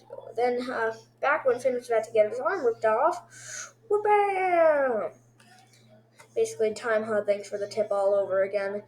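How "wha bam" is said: high, drawn-out bam, sliding down; imitated impact noise. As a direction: yell the hit as a sound effect. Imitates the blow.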